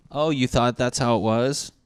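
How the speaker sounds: sarcastic throwback of his own words; mocking echo. Answering the gullible viewer with a sarcastic echo. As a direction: repeat it back to mock him. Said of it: "I thought that was how it was".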